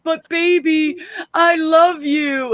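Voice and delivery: put-on high, slow, crying "But baby, I love you"; mock tearful plea. Crackhead girlfriend fakes tears to beggar's crowd, high and sobbing. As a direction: whimper it like a fake-wronged girlfriend.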